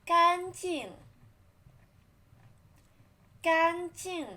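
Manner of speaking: 干 high and flat, 净 dropping; 干净 loud with high 干 and falling 净; first and fourth tones 干净. Shown as a demonstration. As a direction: keep 干 high then drop 净. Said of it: "Read after me".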